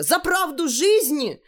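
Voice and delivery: high, drawn-out martyr's slogan; high-pitched melodramatic outcry; theatrical cry. A narrator's reading. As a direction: proclaim it high and slow from captivity. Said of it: "закричала узница".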